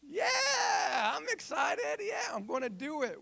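high, loud mock-enthusiastic cheer; hammed-up excitement. Retelling the reply to the excited pastor, exaggerated excitement. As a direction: squeal an over-the-top eager yes. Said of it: "he was excited about it".

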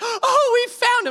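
very high, loud squeal of relief; hammed-up delighted cry; mock overjoyed child. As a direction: imitate a boy's tearful joy. Son overjoyed dog is found.

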